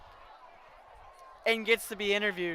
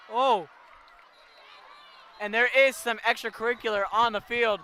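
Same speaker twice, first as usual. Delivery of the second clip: ordinary talk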